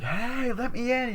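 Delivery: high-pitched call through a window. Retold or imitated. Girl outside calling in at the windows, high pleading call. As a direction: call to be let in, voice pitched right up.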